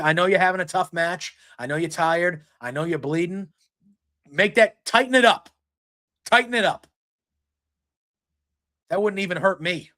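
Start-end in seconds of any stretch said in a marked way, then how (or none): 4.3-6.9 high, loud coaching yell; a fan shouting coaching at the screen; yelling advice. Real, not acted. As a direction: bark at him to tighten the hold.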